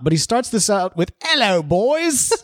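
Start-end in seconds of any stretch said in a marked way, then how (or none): 1.2-2.3 slow, higher mock British "Hello, boys."; drawn-out hammy British "Hello, boys."; teasing a listener's greeting in a put-on British voice. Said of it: "Joel's from foggy London town".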